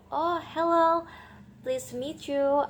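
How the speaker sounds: cheerful reply. Shown as a demonstration. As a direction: greet back cheerfully as if pleased. Modelling the reply. (balasan ceria, suara tinggi)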